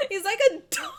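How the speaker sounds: high, loud, laughing exasperated rant at the screen; frustrated fangirl outburst. Real, not acted. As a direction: squeal it out, half laughing and half fed up. Fangirl outburst over game character.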